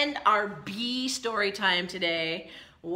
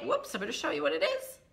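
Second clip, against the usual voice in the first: ordinary delivery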